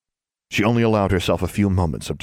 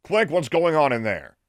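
demanding; 9 semitones higher than usual. Acted. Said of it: "demanded Thiel".

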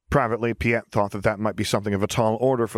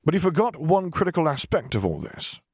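smug, oily superior voice; 8 semitones higher than usual. Acted. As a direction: lord it over him, pleased with yourself. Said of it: "a supremely smug Ozzel pointed out".

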